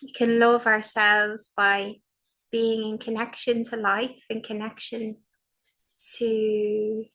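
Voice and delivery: slow, spacious meditation voice with long pauses; guided meditation. Acted. Teacher guiding a sitting practice, slow and soothing. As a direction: guide the group into calm, one phrase at a time.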